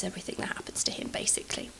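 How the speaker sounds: whispered aside to viewers; whispering the point of the story. Real, not acted. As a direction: drop to a conspiratorial whisper. Aside to the audience.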